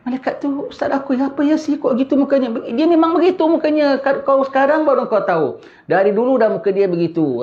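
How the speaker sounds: very high, scornful voice of the dead; mocking spirit voice. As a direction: sneer it out up high. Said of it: "Dia nampak".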